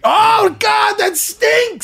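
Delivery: high, slow disgusted shout; smelling something awful. Real, not acted. Reacting to the stench.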